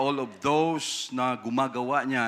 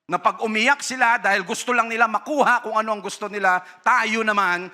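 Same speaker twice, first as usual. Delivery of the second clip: louder, higher impassioned preaching; forceful preaching; 6 semitones higher, 5 dB louder than usual. Real, not acted. Preacher warning parents, loud and impassioned. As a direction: drive the point home to the congregation.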